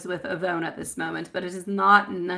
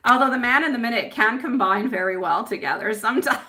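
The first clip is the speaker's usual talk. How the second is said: laughing; 3 semitones higher, 5 dB louder than usual. Real, not acted. Psychic joking to viewers about men and minutes, laughing. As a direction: joke said with a laugh in the voice.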